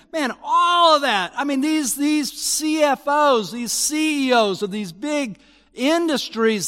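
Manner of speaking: rising indignant cry; excited outburst. Acted. Preacher mocks greedy businessmen.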